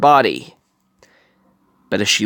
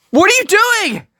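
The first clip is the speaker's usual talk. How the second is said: much higher, voiced as a panicked girl; edge of panic; 3 dB louder than usual. A narrator's reading. Honey grabs Tadashi's sleeve as he heads for the fire, panicked. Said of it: "her voice on the edge of panic".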